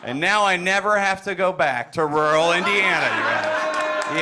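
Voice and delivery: high, loud, over-the-top pandering stand-up; pandering hack comic. Acted. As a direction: play to a room you think is dim, all bright and overeager. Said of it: "get into character by pretending you fine folks are a lot stupider".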